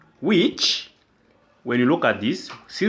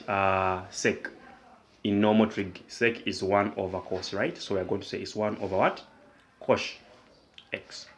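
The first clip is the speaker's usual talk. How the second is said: usual speaking voice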